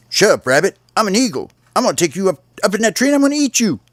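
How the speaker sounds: high, puffed-up bird voice bragging; a tiny bird playing tough eagle, high and fast at the end; boastful little bird. Little bird brags to rabbit, high puffed-up voice.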